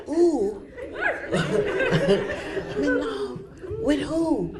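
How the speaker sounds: high, drawn-out, laughing; giddy laughing surprise. Retold or imitated. Answering the Spirit, high and laughing in surprise. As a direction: stretch "in love" slowly through a laugh.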